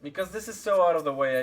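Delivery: ordinary talk, nothing marked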